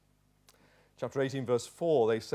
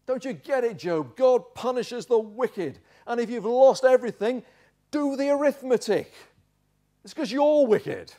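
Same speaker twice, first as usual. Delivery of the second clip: loud, high accusing rebuke; hammed-up exasperated accuser; berating; 7 semitones higher, 6 dB louder than usual. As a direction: hammer it home at raised pitch. Bildad berating Job.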